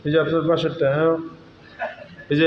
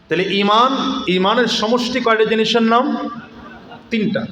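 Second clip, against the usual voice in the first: raised, rousing sermon voice with shouted peaks; fiery waz preaching; 4 semitones higher than usual. Acted. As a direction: preach it out to the crowd with rising force. Preacher builds to full force.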